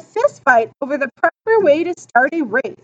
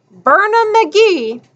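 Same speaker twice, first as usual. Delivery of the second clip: echoed cheer; 6 semitones higher, slower than usual. A narrator's reading. Narrator echoes the crowd's cheer.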